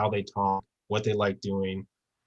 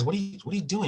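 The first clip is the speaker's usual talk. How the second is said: put-on pitched-up, startled kid asking what's going on; alarmed question; 9 semitones higher, 4 dB quieter, much faster than usual. Kid alarmed as the mentor leads him into the sea. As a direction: sound a little thrown as the water rises. Kid confused, led into water.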